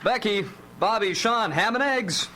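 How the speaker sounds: high, slow call through the cabin. Acted. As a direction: call the kids to breakfast. Dad calls kids to breakfast.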